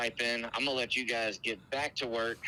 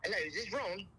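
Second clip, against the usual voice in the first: high-pitched put-on British accent; 6 semitones higher, 4 dB quieter than usual. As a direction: prank caller putting on a British voice. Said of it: "the best British accent I've ever heard".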